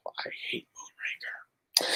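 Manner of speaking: very soft, slow whisper after a sigh; sigh, then whisper it with loathing. Real, not acted. Streamer confessing his least favorite Bond movie, sighing whisper.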